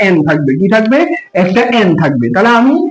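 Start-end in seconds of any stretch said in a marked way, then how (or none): none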